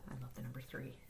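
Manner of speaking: soft whispered secret; hushed, fond aside. Real, not acted. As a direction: whisper it like a confession. Confiding aside.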